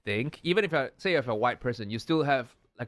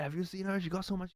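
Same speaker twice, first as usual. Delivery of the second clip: higher, softer catty whisper-gossip; mock gossip; 6 semitones higher, 7 dB quieter than usual. Mocking two-faced friends, snide gossip. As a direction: hiss gossip behind her back. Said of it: "talk shit about it behind your back".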